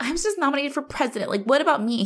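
high, quick upset protest; mock-indignant whine about her election. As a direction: complain fast like an offended student. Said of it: "Lucy's upset because she's like".

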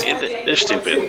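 soft unvoiced mutter; whispering. Real, not acted. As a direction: whisper it under breath. Muttered aside.

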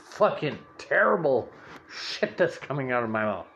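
ordinary delivery

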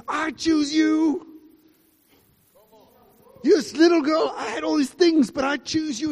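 tender declaration. Retold or imitated. Grandfather telling the little girl he chooses her. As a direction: declare it like a father's promise. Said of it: "He says".

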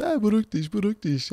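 high-pitched mock placating; father placating. Father fobs off doubting child. (hoch nachgeäfftes Beschwichtigen)

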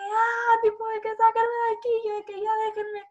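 high, loud desperate begging; hysterical pleading. Retold or imitated. Girl begging family to get her out, high hysterical plea. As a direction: beg to be let out, crying. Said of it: "histérica".

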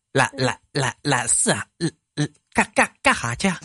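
lightly voiced stammering comedian; mild stutter impression; stuttering comic imitation. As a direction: stammer through it like a sitcom bumpkin. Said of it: "我给你学几个人".

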